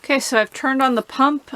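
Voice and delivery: ordinary talk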